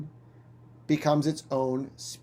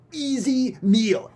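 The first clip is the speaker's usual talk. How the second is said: smug predator; 8 semitones higher, slower than usual. Acted. Bird sizing up prey.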